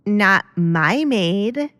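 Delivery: very slow, possessive objection; disagreeing daughter. Acted. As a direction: drag out each word like a spoiled girl. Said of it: "Kate disagreed".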